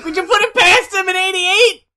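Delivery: high excited half-shout; excited yell. Real, not acted. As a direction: shout it out in disbelief. Mocking the artist.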